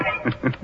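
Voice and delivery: soft creepy host laugh; sinister chuckle. Acted. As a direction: chuckle at your own dark joke. Horror host ends his joke.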